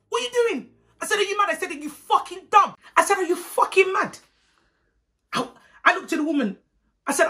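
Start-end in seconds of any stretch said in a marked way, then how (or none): 0.1-4.2 high-pitched incredulous challenge; indignant outburst. Retold or imitated. Girl confronting auntie who took the food, high indignant outburst. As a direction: pitch it right up in retold outrage. Acted quarrel after food is grabbed.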